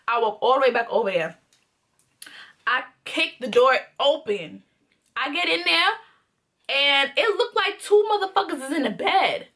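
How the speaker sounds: normal talking voice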